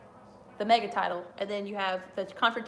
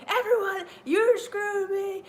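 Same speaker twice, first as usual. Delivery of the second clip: high, slow mock promo voice; imitating Bret Hart; 10 semitones higher, 4 dB louder than usual. Retelling Bret Hart's promo, a high mock rant. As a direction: ham up an angry wrestler's promo. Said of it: "And he was like".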